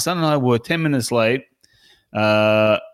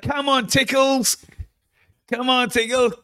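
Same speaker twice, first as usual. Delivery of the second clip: high-pitched mock taunting; goading call, pitched far up; imitating heckling; 11 semitones higher than usual. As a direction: rib a big teammate from the sideline. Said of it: "He was just giving it to him the whole time".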